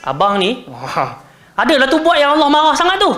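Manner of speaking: high-pitched accusing wife's reproach; blaming. Shown as a demonstration. Wife scolding her husband as the wrong reading, blaming. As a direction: jump up high to pin blame on him. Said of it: "Macam salahkan Nabi".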